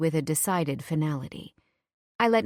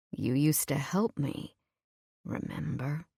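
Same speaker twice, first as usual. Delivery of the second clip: hushed tease; 3 semitones lower, 6 dB quieter than usual. Acted. Vincent teasing Eve, hushed.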